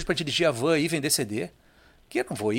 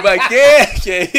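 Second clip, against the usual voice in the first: loud, laughing "what is this?"; laughing disbelief; 7 semitones higher, 12 dB louder, slower than usual. Retold or imitated. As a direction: blurt it out through laughter. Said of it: "Aí eu digo".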